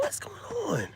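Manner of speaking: squeaky high mock-friendly voice; high falsetto greeting. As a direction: pitch way up, sweet and fake. Voicing himself greeting women.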